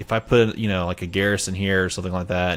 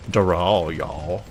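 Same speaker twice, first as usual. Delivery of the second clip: Southern drawl on "Daral, y'all", lower pitch; southern accent; 3 semitones lower, much slower than usual. Shown as a demonstration. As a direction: play up a Southern accent, dropping low. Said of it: "gives me the southern accent".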